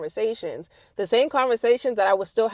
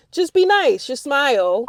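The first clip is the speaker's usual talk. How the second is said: louder, higher plea; emphatic advice; 5 semitones higher, 7 dB louder than usual. Real, not acted. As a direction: insist on it. Exhorting listeners.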